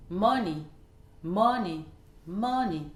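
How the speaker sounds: MO stressed in "Money", then falling; first-syllable stress. Shown as a demonstration. Teacher models a common word, stress on MO in "Money". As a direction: hit the start: MO-ney. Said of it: "l'accentuation sur la première syllabe".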